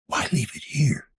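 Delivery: hushed, breathy question under the breath; whisper. Acted. Detective whispering his question while searching the room. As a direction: whisper it so no one nearby hears.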